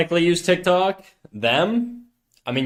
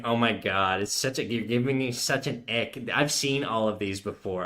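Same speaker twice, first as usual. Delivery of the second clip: softer, lightly mocking imitation of a disgusted girlfriend; lightly mock her "ick" in a lowered voice; 3 semitones lower, 8 dB quieter than usual. Girlfriend disgusted by his old Instagram memes, mildly mocking voice. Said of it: "your girlfriend voice".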